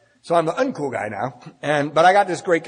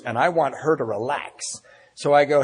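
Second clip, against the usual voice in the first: ordinary talk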